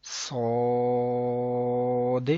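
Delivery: صاد with long heavy o and bounced final d; elongated letter. Shown as a demonstration. Teacher recites the letter, long صاد with echoed d. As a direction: hold صاد full, release the d. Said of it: "تین second لمبا کریں گے".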